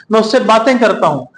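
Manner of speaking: ordinary talk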